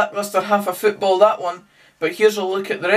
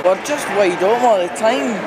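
usual speaking voice

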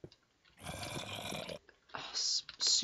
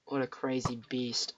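usual speaking voice

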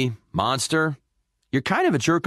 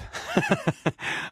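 laughing at his own joke; high giggling laugh; 5 dB quieter than usual. Acted. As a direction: break into high, pleased chuckles. Laughs at own fist bump joke.

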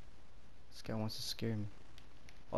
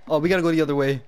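being chased; 5 semitones higher, 4 dB louder, faster than usual. Real, not acted. Fleeing monster in game.

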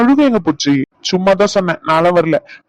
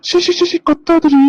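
high-pitched, flustered shushing; alarmed shush, pitch jumping up; urgent hushing; 9 semitones higher, slower than usual. Acted. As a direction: hush her quickly before anyone hears. Hushes the crying wife urgently.

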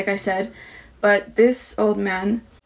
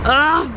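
short loud cry of frustration; frustrated cry; 5 semitones higher, 5 dB louder than usual. Real, not acted. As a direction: let out a sharp annoyed "ah". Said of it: "So frustrated".

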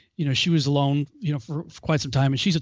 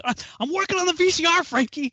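much higher, fast, strained explaining; exasperated answer; 12 semitones higher than usual. Retold or imitated. He answers the parrot, exasperated, high and rushed. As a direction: spit it out to make the bird stop. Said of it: "And I'm like".

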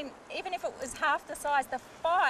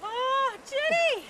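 excited; 8 semitones higher, slower than usual. Real, not acted. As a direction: cry out with joy. Fish landed.

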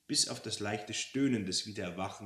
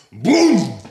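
sudden loud, drawn-out boom; shouted crash noise; booming impact; 11 semitones higher, 20 dB louder than usual. Acted. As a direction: cut in with an explosive bang. Giant fist smashes down mid-sentence.

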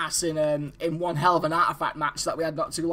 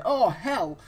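normal talking voice

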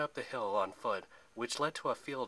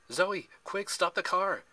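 high, loud panicked shout; urgent cry; 8 semitones higher, 6 dB louder, slower than usual. A narrator's reading. Percy warns Zoe at the wheel, urgent high shout. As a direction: cry out a sudden warning. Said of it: "he cried".

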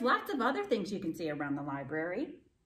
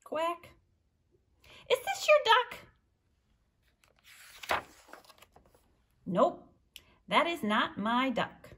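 lively read-aloud with high character voices; storytime reading; 8 semitones higher, 7 dB louder than usual. A narrator's reading. Librarian reading to children, animated character voices. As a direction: read the duck story to the kids, bouncing between voices. Reads picture book with voices.

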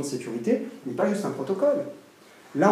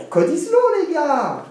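high, loud mock rallying cry; slogan crié; 13 semitones higher, 7 dB louder, slower than usual. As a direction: shout it like a crypto zealot's battle cry. Slogan des autres, lancé puis opposé. (slogan scandé, très aigu et fort)

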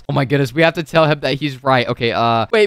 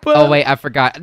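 ordinary talk, nothing marked

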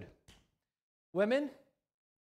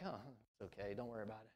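much softer, lower, careless; mock nonchalant shrug; 8 semitones lower, 15 dB quieter, much faster than usual. The indifferent majority shrugging off sin, in a soft careless mumble. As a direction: toss it off like it doesn't matter. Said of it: "the majority were like".

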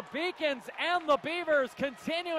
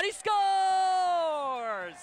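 long goal yell; 3 semitones higher than usual. Real, not acted. Goal call held long.

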